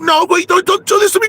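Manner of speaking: very high, loud desperate plea; frantic begging. Acted. Conchita begging not to be thrown out, frantic. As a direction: beg him not to do it.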